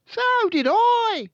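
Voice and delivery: put-on very loud, very high boy's cry; high-pitched panicky brother; alarmed brother. A narrator's reading. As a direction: yell it as a frightened boy. Brother alarmed, intruder feared.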